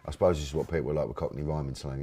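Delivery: usual speaking voice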